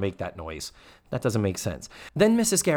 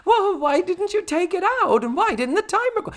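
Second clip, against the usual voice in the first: shrill high mock outrage; mock flustered Mrs. Garrett; 4 dB louder than usual. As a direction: squeal it like a panicked cook. Mocking Mrs. Garrett finding burnt roast.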